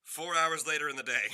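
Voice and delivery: loud, high-pitched playful proclamation; big, booming punchline; loud teasing announcement. Real, not acted. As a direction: belt it out high to tease a buddy. Game master finishes mock announcement.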